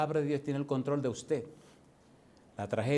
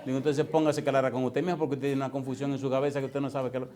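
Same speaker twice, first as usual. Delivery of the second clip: ordinary talk, nothing marked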